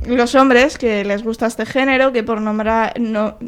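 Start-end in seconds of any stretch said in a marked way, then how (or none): none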